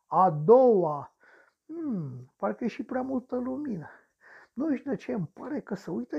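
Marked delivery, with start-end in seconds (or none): none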